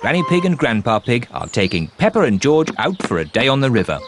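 normal talking voice

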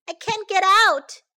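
high, loud cry of distress. A narrator's reading. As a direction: call out as if stuck. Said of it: "cried Sam".

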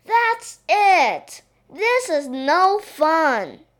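put-on high, loud child's tantrum; indignant storybook shout; fed-up outburst. A narrator's reading. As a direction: cry out in exasperation, slowing at the end. Princess drops spade and quits.

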